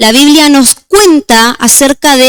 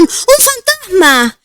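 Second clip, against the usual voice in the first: high, slow, fearful cry of a ghost sighting; frightened cry; 8 semitones higher, 4 dB quieter, slower than usual. A narrator's reading. Disciples see a figure on the water, frightened high cry. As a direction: cry it out in terror, stretched. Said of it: "llenos de miedo gritaron".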